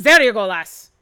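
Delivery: lightly voiced gruff dwarf; gruff dwarf host. Dwarf offers makeshift stool.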